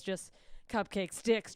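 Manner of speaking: ordinary talk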